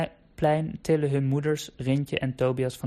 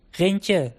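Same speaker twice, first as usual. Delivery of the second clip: higher, louder call into the fog; 6 semitones higher, 5 dB louder than usual. Acted. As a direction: call his name from a distance. Tobias calling through the fog.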